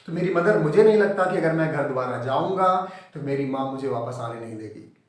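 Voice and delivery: ordinary talk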